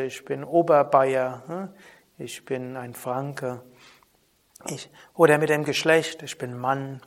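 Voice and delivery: ordinary talk, nothing marked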